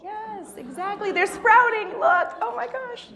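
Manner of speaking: thrilled teacher cooing; delighted excitement. Real, not acted. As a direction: marvel at the little sprouts. Kids raise palms like plants.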